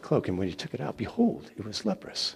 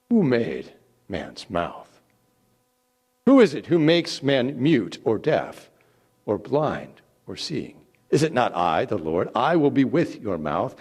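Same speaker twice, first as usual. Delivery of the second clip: stern; 2 semitones higher, 7 dB louder than usual. Acted. God rebukes Moses' excuses.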